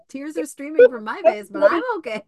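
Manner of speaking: high, loud, half-laughing protest; amused loud mock reassurance; loud laughing insistence. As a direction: laugh while insisting you're fine. Acting herself crying yet fine.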